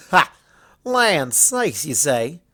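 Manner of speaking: a stubborn country mare refusing help; Applejack voice. Acted. Applejack turning down Luna's offer, folksy and firm.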